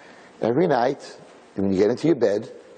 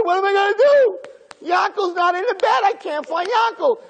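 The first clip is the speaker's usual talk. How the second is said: put-on panicked kid. Yankel panicking over his empty bed, high frantic cry. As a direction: cry out like a desperate child. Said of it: "He said".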